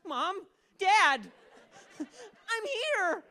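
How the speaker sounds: very high, thin call; high-pitched plaintive hail; calling to parents. Acted.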